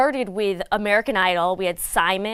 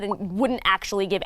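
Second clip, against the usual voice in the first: ordinary talk, nothing marked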